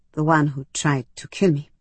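usual speaking voice

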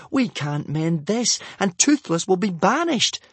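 put-on boy wailing in despair. A narrator's reading. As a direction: lament the disaster.

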